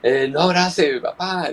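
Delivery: put-on high, pleading child's voice; mock little kid scolding dad; a light impression of his worried kid; imitating his child. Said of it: "me dice".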